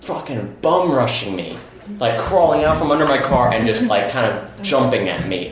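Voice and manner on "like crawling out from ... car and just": ordinary talk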